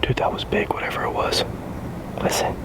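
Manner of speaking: hushed nervous whisper. Real, not acted. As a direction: breathe it out scared. Spooked in the woods at night.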